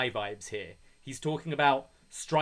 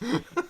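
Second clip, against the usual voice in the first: high laugh. Real, not acted. Laughing at injury joke.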